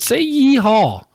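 slow, high-pitched cowboy holler; coaxing yeehaw. Retold or imitated. Prankster asking the agent for cowboy talk, slow high holler. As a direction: draw out YEEHAW high for him to copy. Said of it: "I said, I said".

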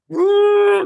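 a sudden high, loud whoop; high loud "woo" of nervous thrill; excited whoop. Retold or imitated. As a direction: let out a giddy yelp of nerves. Nervous excitement entering crypto.

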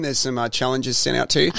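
ordinary talk

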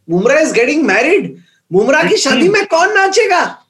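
high-pitched, over-the-top breaking news; mock-frantic excitement. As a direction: hype it up like wedding gossip. Mock-urgent questions about Bumrah's wedding.

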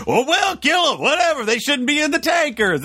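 high, sing-out mock indifference; mock dismissive. Panelist imitating a candidate shrugging off innocents on tankers, dismissive. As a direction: wave the objection away in a high voice.

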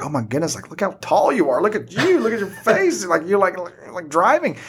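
put-on high, delighted "look at you" to a grown kid; amazed greeting. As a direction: gush over how tall they got, laughing. Teachers greeting grown former students.

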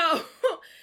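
high burst of laughter; real laugh breaking through; loud laugh. Real, not acted. Speaker laughs loudly recalling her stubbornness.

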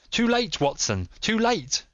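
high, agonized cry of alarm; urgent cry. A narrator's reading. Holmes calls to Watson running up behind, crying out. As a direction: cry out that all is lost. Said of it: "cried Holmes".

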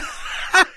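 high squeaky giggle. Acted. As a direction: giggle like a tiny squeaky man. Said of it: "piepste das Männchen".

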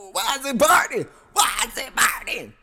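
high, loud pained cry of alarm; pained yell. Retold or imitated. Telling listeners about the soap burning, a high pained shout. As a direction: shout it like it suddenly stings. Retelling painful burn while masturbating.

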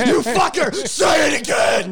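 angry yell. Retold or imitated. Producer yelling at the host, mock furious. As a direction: yell it at him, hammed-up. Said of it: "Tamara gives me the look".

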